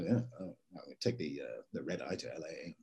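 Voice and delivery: a hint of a British manager's put-on American accent; transatlantic twang. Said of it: "developed this kind of transatlantic thing".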